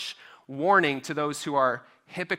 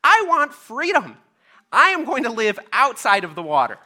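defiant outburst; 8 semitones higher, 6 dB louder than usual. Acted. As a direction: shake a tiny fist and demand freedom. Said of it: "shook his little fin at God and said".

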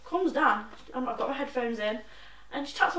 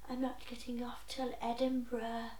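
put-on whispered old lady excuse; a hushed, pitiful mock plea; 9 dB quieter than usual. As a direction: breathe it out like a frail old woman. Mimics old lady's excuse.

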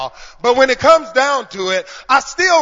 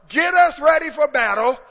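ordinary talk, nothing marked

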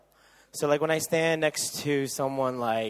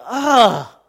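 mock disgusted girls; 9 semitones higher, 12 dB louder, much slower than usual.

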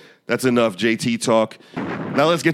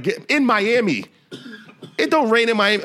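mock disbelief; 9 semitones higher than usual. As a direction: scoff like it never rains there. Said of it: "I was like".

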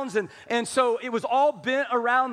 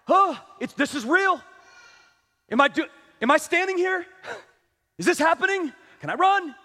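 higher, startled bridal jitters; giddy anticipation; 4 semitones higher than usual. Acted. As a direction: gasp it out in nervous disbelief. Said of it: "kinda showing me of this anticipation".

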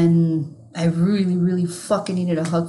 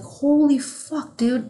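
stunned shock; 6 semitones higher, slower than usual. Retold or imitated. Reacting to coworker's father dying.

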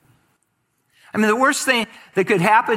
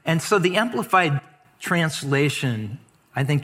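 ordinary talk

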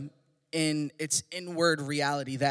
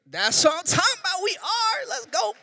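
much higher, excited cheer; hype; 12 semitones higher than usual. Real, not acted. Pastor hyping the kids, high excited cheer. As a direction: pump up the room.

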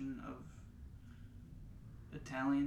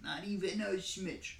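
mock Staten Island rant. Ranting in the mocked accent.

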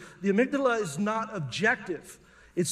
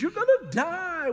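high, stretched-out mock shriek; panicked scream; 8 semitones higher, 4 dB louder, slower than usual. Amygdala screaming at a man swimming in a lake, panicked. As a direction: wail the warning with the last word drawn out. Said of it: "the amygdala screams".

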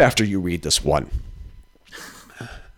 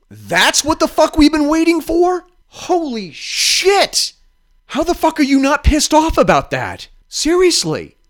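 outraged disbelief; 12 semitones higher than usual. Real, not acted. Venting to a friend about the report, shrill outrage.

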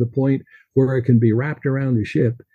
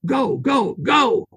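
yelled; 11 semitones higher, much slower than usual. Acted. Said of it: "Jack yelled".

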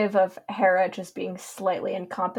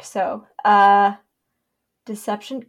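ordinary talk, nothing marked

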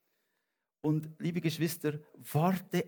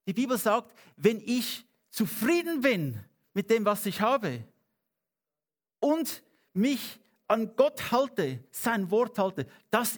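higher, louder, building preacher's call; fervent preaching; 6 semitones higher, 4 dB louder than usual. Acted. Pastor urging the congregation, fervent higher louder preaching. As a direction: preach it out, rising with conviction.

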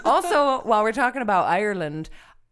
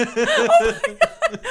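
high, slow laughing exclamation; laughing at the flub; 12 semitones higher, 8 dB louder, slower than usual. Real, not acted. Laughing at own flubbed joke.

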